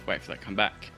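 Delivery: ordinary talk, nothing marked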